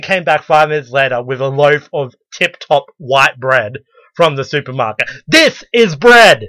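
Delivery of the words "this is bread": high, loud, slow crying shout; triumphant shout. Retold or imitated. Customer back with a supermarket loaf, shouting triumphantly. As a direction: bellow it like a victory.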